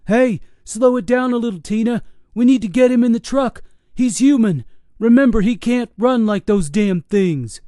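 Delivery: high, loud urgent scream. Acted. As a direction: yell through the cab window. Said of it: "Patrick screamed into the window".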